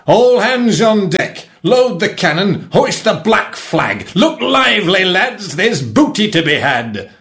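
high, drawn-out commands to crew; rally the pirates for attack; rousing pirate captain bellowing orders; shouting captain. A narrator's reading. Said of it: "he shouted".

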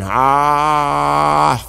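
long, steady held "ah"; open-mouthed waiting. Acted. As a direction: hold an "ah" with mouth wide open. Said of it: "his mouth wide open".

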